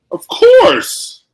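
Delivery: high, loud squeal on "course"; exaggerated agreement. Co-host agreeing on the Chiefs pick, hammed-up high squeal.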